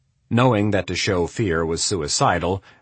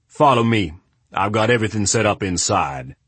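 lightly voiced booming man; a hint of a big voice; 4 dB louder than usual. A narrator's reading. Adams voiced.

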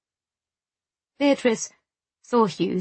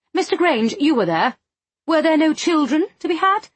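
high, louder, demanding lady; put-out committee wife, pitched up and insistent; agitated society lady; 8 semitones higher, 4 dB louder than usual. Acted. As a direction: appeal to him in a high fluster, rising at the end. Narrator voices agitated Missus Fothergill.

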